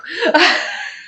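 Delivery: high-pitched giggle; real, spontaneous laughter. Real, not acted. Amused during live chat.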